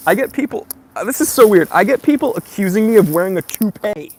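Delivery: loud, excited disbelief; practically shouting in disbelief. Real, not acted. Excited rant about toupee accusations.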